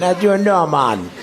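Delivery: mock Jamaican accent. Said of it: "Maybe it's more Jamaican".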